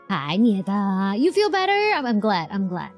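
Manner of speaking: ordinary delivery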